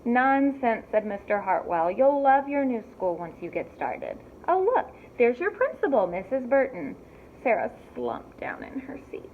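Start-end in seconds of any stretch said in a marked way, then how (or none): 0.0-0.9 louder, firm brush-off; father dismissing daughter's complaint; brisk dismissal. Acted. 1.9-6.9 louder, higher bright pointing out; father cheerfully spotting the principal. Acted. Father upbeat.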